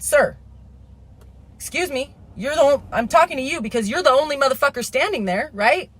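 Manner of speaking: loud and pushy, demanding he turn around; retold yelling. Mother confronting the man camped in the park, loud high yell. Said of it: "yelling was muffled by the rain".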